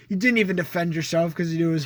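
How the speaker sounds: usual speaking voice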